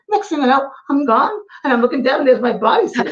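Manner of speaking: high Scottish lady voice; Scottish woman voice. Retold or imitated. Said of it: "And she goes".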